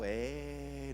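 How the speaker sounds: low, quiet hint of the Mexican stadium chant; a half-hearted mock crowd jeer; stadium jeer imitation. Shown as a demonstration. Preacher half-imitating the Mexican stadium yell, muted. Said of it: "como el grito en México".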